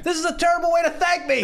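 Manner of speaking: high mock victim voice. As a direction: squeak it out as the dead woman. Voicing the murdered woman jokingly.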